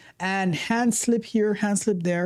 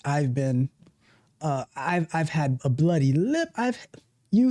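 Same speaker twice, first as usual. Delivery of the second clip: normal talking voice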